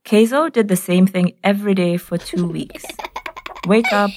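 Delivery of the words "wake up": usual speaking voice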